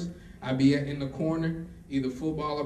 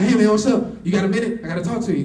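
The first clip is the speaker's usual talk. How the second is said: eager excited caller; 6 semitones higher, 12 dB louder, much faster than usual. Retold or imitated. Excited call to mentor.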